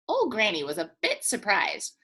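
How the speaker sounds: ordinary delivery, nothing marked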